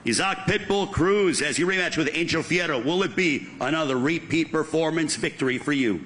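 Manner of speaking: normal talking voice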